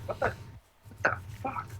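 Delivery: breathless startled whisper; a shocked hushed whisper at spilled water. Pretend. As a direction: hiss it under your breath in surprise. Muttered at water everywhere.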